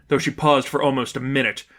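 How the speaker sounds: ordinary delivery, nothing marked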